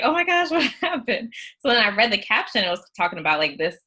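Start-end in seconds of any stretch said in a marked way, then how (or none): 0.0-1.3 high startled exclamation; shocked gasp. Retold or imitated. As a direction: react with sudden alarm. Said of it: "I was like".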